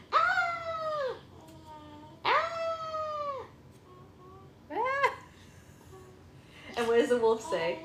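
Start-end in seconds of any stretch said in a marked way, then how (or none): 0.1-5.1 very high, thin mock squeal; repeated high-pitched pretend piggy screams. Shown as a demonstration. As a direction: squeal like a little pig for a toddler. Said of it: "Does the piggy go".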